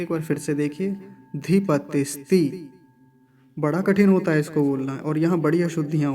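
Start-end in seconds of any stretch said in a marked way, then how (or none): 1.4-2.7 धीपतिस्ति drawn out slowly as one word; slow careful pronunciation. Shown as a demonstration. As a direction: stretch out धीपतिस्ति slowly so students follow. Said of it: "कैसे बोलेंगे". (धीपतिस्ति धीरे धीरे एक साथ)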